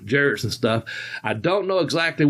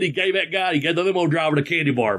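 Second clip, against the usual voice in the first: ordinary delivery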